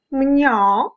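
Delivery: high-pitched spoken "mnya" meow; high cat mew; cat's meow. Acted.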